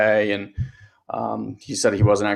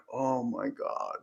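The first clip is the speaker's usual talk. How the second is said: slow, much softer, stunned; appalled; 11 dB quieter, much slower than usual. Retold or imitated. Parent seeing her son's giant froyo cup, stunned and hushed. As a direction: let it fall out under your breath in disbelief. Appalled at the huge ice cream.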